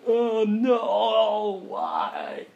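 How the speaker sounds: high, drawn-out mock wail; hammed-up tearful lament; mock crying. Believer who messed up the GPA with God, mock crying. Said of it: "and the waterworks".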